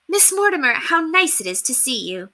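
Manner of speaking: a high, bright hello with a smile; bright polite greeting. A narrator's reading. Penelope greets Miss Mortimer with a bright, formal smile. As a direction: greet her old headmistress warmly but properly. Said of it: "she smiled, extended her hand, and spoke".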